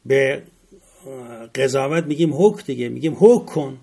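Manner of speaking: ordinary talk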